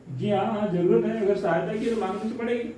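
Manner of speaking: normal talking voice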